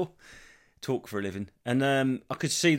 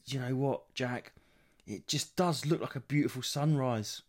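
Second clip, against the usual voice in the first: usual speaking voice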